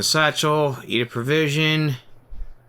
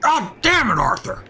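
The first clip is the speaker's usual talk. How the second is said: higher, exasperated gang boss; Dutch voice; 11 semitones higher than usual. Acted. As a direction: scold him like an annoyed leader. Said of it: "I think my Dutch voice is a lot better".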